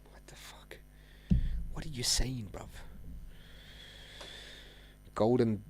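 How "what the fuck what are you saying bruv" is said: unvoiced whisper of disbelief; breathless whisper, shocked. Real, not acted. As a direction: hush it, stunned. Disbelief at guru claim.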